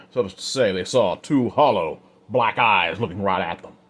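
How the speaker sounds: usual speaking voice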